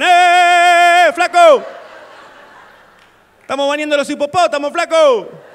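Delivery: high, loud shout from far off; grito lejano. Pretend. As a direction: yell up through the pipe from Africa. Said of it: "de ese aire me gritan". (grito agudo desde lejos por el caño)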